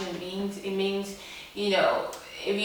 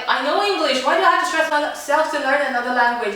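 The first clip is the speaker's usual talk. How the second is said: higher, louder protest; exasperated complaint; 7 semitones higher, 5 dB louder than usual. Retold or imitated. Schoolgirl complaining about learning another language, exasperated. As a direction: plead indignantly against having to study.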